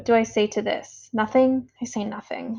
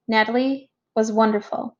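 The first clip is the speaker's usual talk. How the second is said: usual speaking voice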